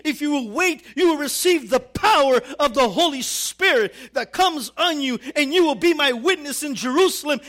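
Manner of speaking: loud, emphatic sermon delivery; high, driving preacher's voice; full-force preaching. Real, not acted. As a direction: preach it out to the whole congregation.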